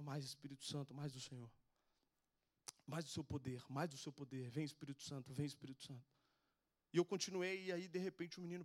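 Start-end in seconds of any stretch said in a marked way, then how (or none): 0.0-6.1 low, soft repeated invocation; retold prayer. Man praying over a screaming boy in the street, soft low prayer. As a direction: re-enact praying softly over someone. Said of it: "E eu fiquei orando".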